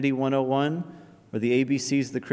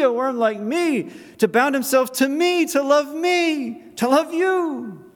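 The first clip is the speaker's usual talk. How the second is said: much higher, louder, slowing at the end; soaring preaching; 9 semitones higher, 4 dB louder than usual. Acted. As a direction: swell into ecstatic praise. Preaching builds to a climax.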